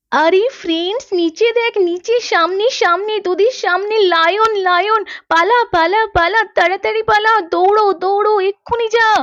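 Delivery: put-on girl spots a lion behind her friends, panicking; frantic warning. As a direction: yell at the kids to flee now.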